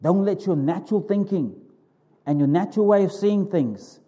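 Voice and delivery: ordinary delivery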